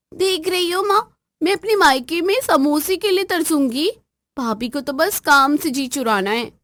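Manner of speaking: high, whiny complaining demand; spoilt sister-in-law. Acted. Sister-in-law nagging her bhabhi over tea, high whiny voice. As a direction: whine about the missing snacks. Neelam whines to mother.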